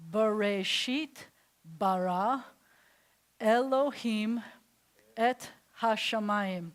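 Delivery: slow, syllable-by-syllable Hebrew reading; measured teacher's read-aloud, pausing between words; slow model reading. Shown as a demonstration. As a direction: sound out each word slowly for learners.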